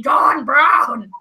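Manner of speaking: loud, booming documentary-style proclamation; John Brown. Shown as a demonstration. Promising co-host how the name will sound, loud mock-dramatic proclamation. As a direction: bellow the name with grand drama. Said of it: "I'll do it in the John Brown voice".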